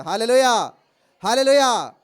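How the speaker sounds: loud, ringing hallelujah; hallelujah shout. Real, not acted. Preacher shouting hallelujah to the congregation. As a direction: call it out twice to the church.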